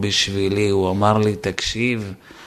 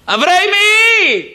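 loud, very high, stretched shout of the name; retold yell; 7 dB louder, slower than usual. Guest yelling again for the boy from stress, loud and high. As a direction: bellow for the boy in panic. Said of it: "יצא לי צעקה".

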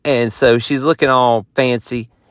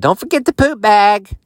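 wife reminding; 8 semitones higher, 4 dB louder than usual. Retold or imitated. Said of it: "Amy's like".

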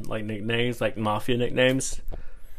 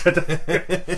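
laughing protest; 7 semitones higher than usual. Real, not acted. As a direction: say it while laughing. Friend laughing at nickname.

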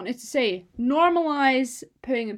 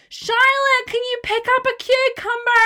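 much higher and louder call across the shop; mum calling loudly; 12 semitones higher, 8 dB louder than usual. Retold or imitated. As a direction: call across a whole supermarket. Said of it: "halfway across the shop being like".

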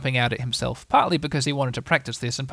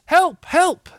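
high, loud storybook cry for help; 7 dB louder than usual. Acted. Lost Piglet wishing to call out, alarmed and high.

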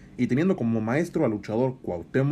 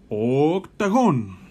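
slow, drawn-out name call; ring announcer call; 5 dB louder, much slower than usual. Acted. Ring introduction of a wrestler.

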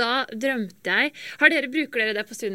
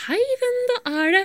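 higher imitated dad, gentle; 5 dB louder than usual. Dad answers the phone at night.

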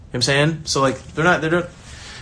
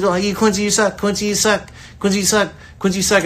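a hint of a sneering hater, higher; mocking hater taunt; 5 semitones higher than usual. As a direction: toss it off like a playground jeer. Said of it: "keep letting you know".

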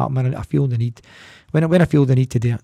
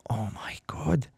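a sharp, much softer whispered gasp of words; shocked whisper; 11 dB quieter, slower than usual. Retold or imitated. Seeing the dirty shower water, a stunned whisper. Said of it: "And I'm going".

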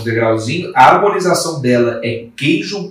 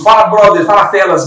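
higher, louder mock host's greeting; imitated catchphrase greeting; 6 semitones higher, 5 dB louder than usual. Guest quotes a copycat's show greeting, imitated catchphrase intonation. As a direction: toss it out like someone's signature opener. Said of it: "mesma entonação e tal".

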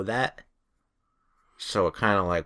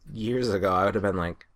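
ordinary talk

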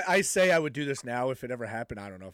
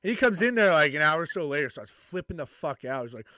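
usual speaking voice

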